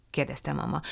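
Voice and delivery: usual speaking voice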